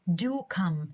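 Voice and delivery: "do" lifted, "come" falling away; stress on DO. Shown as a demonstration. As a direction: lean hard on "do". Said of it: "عملت stress على do".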